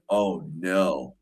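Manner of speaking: ordinary talk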